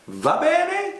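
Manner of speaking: cheerful taxi driver asking if all is well; Italian cabbie. Retold or imitated. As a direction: casually check on shaken passengers. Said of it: "he just looks back and he goes".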